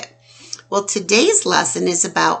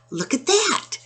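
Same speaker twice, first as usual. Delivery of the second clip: surprised; 11 semitones higher, 3 dB quieter than usual. Acted. Surprised look at picture.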